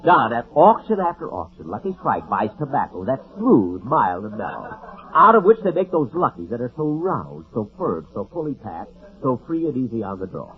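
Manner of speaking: hammed-up commercial read; mock ad pitch. Jack recites the Lucky Strike ad to scold Don, announcer style. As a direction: reel off the sponsor copy like a radio announcer.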